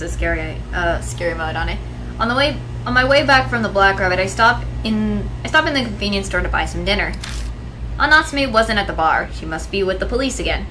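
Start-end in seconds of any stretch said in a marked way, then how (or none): 2.2-10.7 louder, steady storytelling read; story narration. Acted. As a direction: read it like a novel. Reading game narration aloud.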